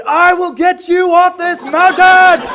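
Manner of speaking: slow, high, full-force mock-heroic yell; movie-hero motivational shout. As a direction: bellow it like a hammed-up action hero. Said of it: "some terrible movie script motivational speech".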